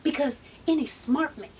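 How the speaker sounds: lightly voiced suave man, softened; a hint of smooth-talking admirer; smooth suitor. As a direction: imitate a charmer making his pitch. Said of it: "He said".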